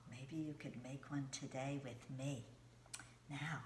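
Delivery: ordinary talk, nothing marked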